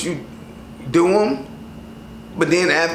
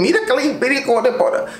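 mock Spanish gibberish, pitched higher; lightly mocking Spanish accent, voice raised; 8 semitones higher than usual. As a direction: tease a Spanish accent up high. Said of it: "That sounded better back then than".

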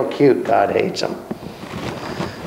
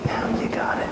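whispered approval; hushed aside, voice dropped out; 9 dB quieter, faster than usual. Real, not acted. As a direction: breathe it out as a secret. Teacher affirms the point.

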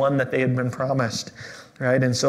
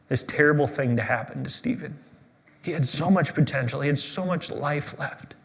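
hushed lament; 5 dB quieter than usual. Real, not acted. Pastor asking God why, hushed and pained. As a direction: drop into a quiet, pained plea.

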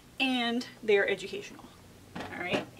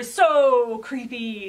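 awed delight; 8 dB louder, much slower than usual. A narrator's reading. Narrator savoring the find.

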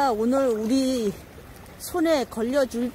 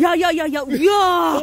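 excited; 6 semitones higher, 10 dB louder, slower than usual. Real, not acted. Excited at full net.

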